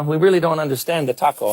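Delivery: ordinary talk, nothing marked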